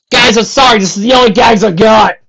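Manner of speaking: loud joking aside. Acted. Narrator joke aside.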